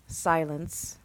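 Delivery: plain reading voice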